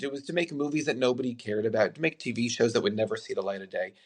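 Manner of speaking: ordinary talk, nothing marked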